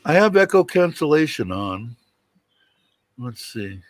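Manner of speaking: ordinary delivery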